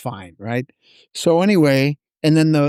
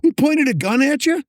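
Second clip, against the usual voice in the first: incredulous; 12 semitones higher than usual. Retold or imitated. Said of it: "I'm almost yelling at him".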